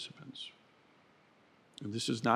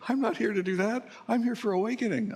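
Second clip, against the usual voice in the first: much higher, louder whiny protest; indignant student; 13 semitones higher, 5 dB louder, faster than usual. Pretend. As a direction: whine like an offended novice.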